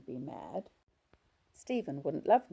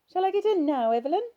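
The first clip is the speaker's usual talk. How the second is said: put-on high, loud little-girl voice from an old woman; high childlike whine, much louder; childish voice; 10 dB louder, faster than usual. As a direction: play an old patient talking like a small child. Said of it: "The childish voice continued".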